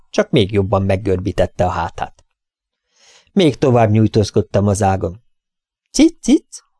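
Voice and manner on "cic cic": very high, sweet "Cic, cic" like melted honey; honeyed cat call. A narrator's reading. Vet reaching along the branch, coaxing the cat in honeyed tones. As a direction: pour "Cic, cic" out high and sugary. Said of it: "Hangom akár az olvasztott méz".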